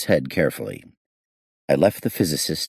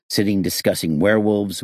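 ordinary delivery, nothing marked

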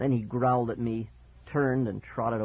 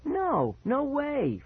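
very high-pitched protest; insistent denial; 13 semitones higher, much slower than usual. Acted. Friend denies part in prank, high and insistent. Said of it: "Fergie insisted".

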